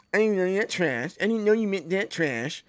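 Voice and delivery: put-on slurred, derisive echo of a kid; mocking parroting. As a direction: mimic the excuse in a mushy, put-on voice. Mockingly repeating the kid's excuse.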